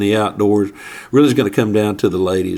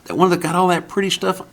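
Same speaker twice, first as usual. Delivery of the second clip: higher, soft innocent question; lightly voiced calm lady hunter; mock lady hunter; 7 semitones higher, 3 dB quieter than usual. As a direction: sound sweetly unbothered. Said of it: "And she goes".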